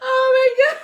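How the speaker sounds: high, loud exclamation through laughter; cracking up. Real, not acted. Co-host reacting to viewers' comments, cracking up. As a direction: gasp it out in a real laughing fit.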